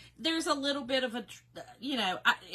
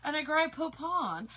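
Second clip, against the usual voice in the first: higher, over-enunciated fancy request; mock-proper speaker; 4 semitones higher than usual. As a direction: parody someone with time to speak properly. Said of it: "No, I ain't got time for that".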